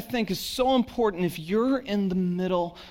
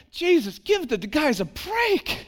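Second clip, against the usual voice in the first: exasperated plea; 7 semitones higher than usual. Retold or imitated. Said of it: "I so was like".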